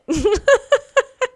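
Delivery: high burst of laughter. Real, not acted. Telling the hotel story, a high burst of laughter. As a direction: laugh at your own drama.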